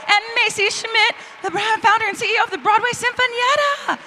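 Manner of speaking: high, loud, hyped-up announcer call; announcer intro. Acted. Host hyping guests to the audience, loud announcer voice. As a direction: bring the guests out like a showman.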